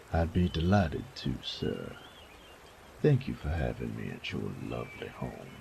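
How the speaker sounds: low, plain-spoken commoner; polite but rough-edged; rough young man. Acted. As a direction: Elijah answering the baron politely. Elijah's voice, lower.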